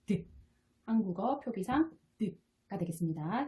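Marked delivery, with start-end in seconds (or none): 0.0-0.3 D as a short "duh"; voiced D sound. Shown as a demonstration. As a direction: voice D with the vocal cords, not the letter name. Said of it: "자, D는".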